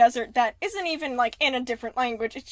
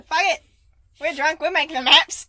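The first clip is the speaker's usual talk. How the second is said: bad Australian accent; 4 semitones higher, 5 dB louder than usual. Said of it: "That was a very bad Australian accent".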